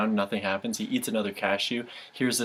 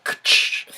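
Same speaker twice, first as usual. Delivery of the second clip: crash sound; 5 dB louder than usual. Acted. Chair dropping sound effect.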